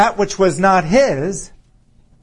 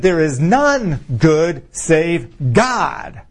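very slow, emphatic scripture quote; solemn pronouncement; much slower than usual. Retold or imitated. Jesus telling the ruler who is good, solemnly. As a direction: give every word its own weight.

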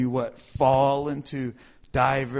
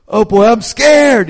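higher, louder, slow scared wail; mock panic; 6 semitones higher, 15 dB louder than usual. Retelling his own loud mock fear at work. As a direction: wail it, half-joking terror. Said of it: "And I'm going".

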